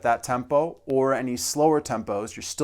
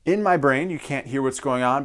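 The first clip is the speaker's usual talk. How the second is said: usual speaking voice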